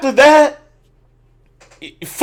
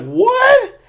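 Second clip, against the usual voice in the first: retold disbelief. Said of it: "I'm like".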